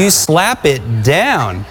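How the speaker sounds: each word slow and firm; slow call-out. Shown as a demonstration. Preacher leading the church in a slow, firm chant. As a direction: lead the crowd saying it slowly. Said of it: "Somebody say".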